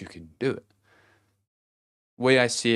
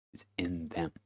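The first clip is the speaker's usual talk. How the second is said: softer, breathy whispered echo; 3 semitones lower, 8 dB quieter than usual. Real, not acted. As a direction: let the line sink to a whisper. Whispered repeat for emphasis.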